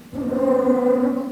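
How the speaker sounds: loud sustained lip trill; vocal warm-up lip buzz, held long; lip bubbling. Shown as a demonstration. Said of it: "buat bubbling".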